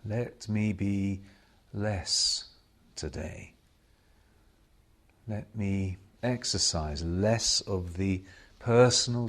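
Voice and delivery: slow, hushed prayer with long silences; meditative prayer. Real, not acted. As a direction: pray each short petition, leaving space after it. Meditative prayer of self-surrender.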